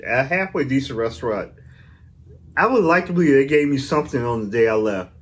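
usual speaking voice

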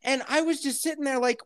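ordinary delivery

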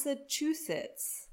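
Massachusetts said "massa-TOO-sits"; own pronunciation. Shown as a demonstration. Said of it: "is this how you say it?".

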